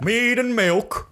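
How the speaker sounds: high, slow hammy peasant voice; high old-timey voice. Acted. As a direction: drawl it out like a silly peasant. Old-timey tavern bit.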